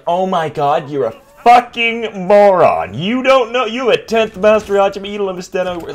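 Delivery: loud, high mock scorn; hammed-up condescending shout; condescending commenters. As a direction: sneer it like an insulting commenter. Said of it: "And people were like".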